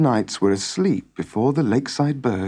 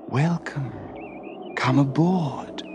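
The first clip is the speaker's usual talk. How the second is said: veiled woman beckoning; 3 semitones higher, 5 dB quieter, slower than usual. Acted. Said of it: "as if to say".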